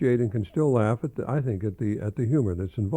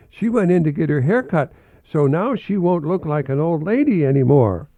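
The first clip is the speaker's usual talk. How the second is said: put-on higher, brighter child's voice; little girl voice; 7 semitones higher, 6 dB louder than usual. Jenny tells Marvin about grandma, in a higher child's voice. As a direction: play the chatty little girl.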